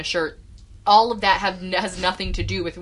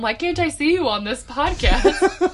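imitating mom; 8 semitones higher, 4 dB louder, slower than usual. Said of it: "Are you like my mom?".

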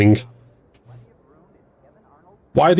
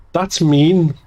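put-on higher, small child's voice, mildly scared; tiny scared voice; 7 semitones higher than usual. A narrator's reading. Little sister reacting to her brother's answer, tiny and scared. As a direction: shrink into a little frightened voice. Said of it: "in a tiny, scared voice".

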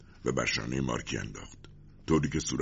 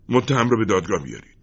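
loud, higher-pitched order; angry command; 6 semitones higher, 7 dB louder than usual. A narrator's reading. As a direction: order it with contained anger. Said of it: "از خشم دندانها را به هم فشرد". (فرمان خشمگینانه)

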